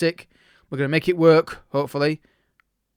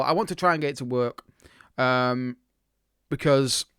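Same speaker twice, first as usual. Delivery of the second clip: normal talking voice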